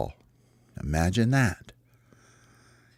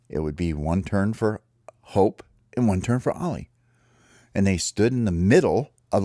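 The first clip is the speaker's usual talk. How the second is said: plain reading voice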